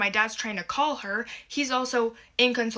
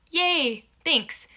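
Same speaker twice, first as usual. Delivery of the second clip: mock-cheerful yay, higher and louder; sarcastic yay; 6 semitones higher, 4 dB louder than usual. Real, not acted. Daughter mocking stepmom's choice of hoodie, sarcastic cheer. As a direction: fake delight, dripping sarcasm.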